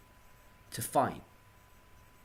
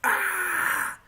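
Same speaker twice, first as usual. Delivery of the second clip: high, loud drawn-out "Ah!"; pained cry; 6 dB louder than usual. Retold or imitated. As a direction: cry out as pain suddenly hits. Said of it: "the next minute you're like".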